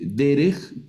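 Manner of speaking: "derech" slowly, stressed on DE; penultimate stress. Shown as a demonstration. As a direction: say "derech" as DE-rej, first syllable strong. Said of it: "se acentúan en la penúltima sílaba". ("derech" despacio, con acento en la primera sílaba)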